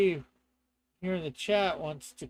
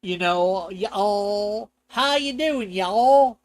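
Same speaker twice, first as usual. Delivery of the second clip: louder mock Southern twang on y'all; fake Southern accent; 8 dB louder, much slower than usual. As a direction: ham up a Southern greeting. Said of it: "a good fake Southern accent".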